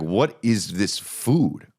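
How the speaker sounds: very slow, drawn-out question; slowly, as if baffled. Retold or imitated. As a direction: stretch it out, word by word. Baffled customer quoting himself, drawn out.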